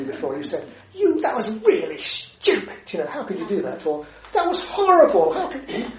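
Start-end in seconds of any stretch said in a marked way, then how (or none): 0.9-3.9 high, loud mock telling-off; imitating someone scolding a friend; critical scold. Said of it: "when we become critical of people". 4.3-5.9 much higher and louder mock outrage; hammed-up indignant reproach; outraged complaint. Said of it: "when we become critical of people".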